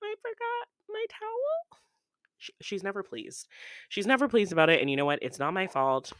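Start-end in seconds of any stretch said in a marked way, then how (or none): 0.0-1.8 very high, soft, sheepish confession; sheepish little voice. Retold or imitated. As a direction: admit it in a tiny guilty voice. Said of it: "And I'm like".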